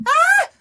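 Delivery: put-on high loud toddler scream; child's scream. Mimicking daughter screaming when frustrated, high and loud. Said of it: "she goes".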